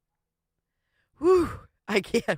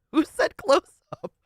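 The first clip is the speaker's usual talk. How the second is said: laughing; 7 semitones higher, 4 dB louder than usual. Real, not acted. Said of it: "cracking up".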